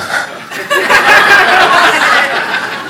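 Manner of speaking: laughing high and long at his own joke; real amused laugh; high burst of laughter. Real, not acted.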